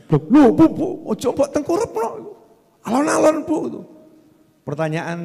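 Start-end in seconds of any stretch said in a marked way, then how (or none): 0.1-3.6 high, fretting husband pleading alon alon; mock panic, much higher and louder; fussy anxious husband. Fussy husband fretting at his wife over the baby, voice higher. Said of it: "Ya, kemudian bayinya ditengkurapkan".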